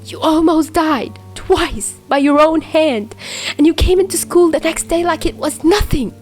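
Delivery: tearful accusation. A narrator's reading. Chuya accusing Dazai of lying about it, tearful and angry.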